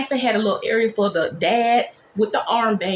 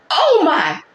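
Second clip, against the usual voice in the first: higher, louder gasp of alarm; 6 semitones higher, 5 dB louder than usual. Retold or imitated. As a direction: react in sudden alarm. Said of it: "The charge nurse goes".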